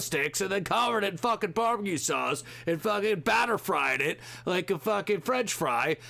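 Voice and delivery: hyped TV food host. Retold or imitated. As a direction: ham up a breathless food show host bragging. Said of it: "on all these fucking shows".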